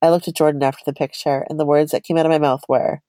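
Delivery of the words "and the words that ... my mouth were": ordinary talk, nothing marked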